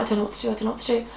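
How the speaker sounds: fast, hushed panicked repeat; muttering to herself in a panic; panicked babble. Retold or imitated. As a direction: rattle it off in a flustered rush. Panicked inner voice, head gone.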